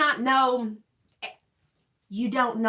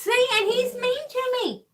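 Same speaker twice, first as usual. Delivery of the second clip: high playful whine; whiny; 12 semitones higher than usual. Pretend. Teasing whine about partner.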